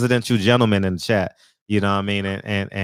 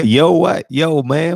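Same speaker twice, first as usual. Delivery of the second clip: louder, higher mock "Yo, what?"; mock loud greeting; 6 semitones higher, 5 dB louder, much slower than usual. Host mimicking the guest's call, loud. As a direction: belt it like a hype greeting. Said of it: "What'd he say?".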